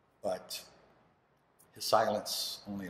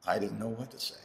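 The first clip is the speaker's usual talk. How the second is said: ordinary talk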